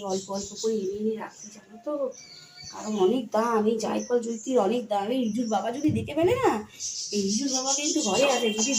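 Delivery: quiet, hushed, like sharing a secret. Real, not acted. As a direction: keep it low so the husband in the house won't hear. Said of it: "চুপি চুপি আস্তে আস্তে বলছি". (চুপি চুপি আস্তে আস্তে)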